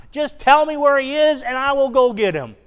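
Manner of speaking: high, loud desperate begging; a grieving woman crying out to strangers; tearful plea. Acted. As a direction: plead through tears for the body. Mary begging the gardener figure.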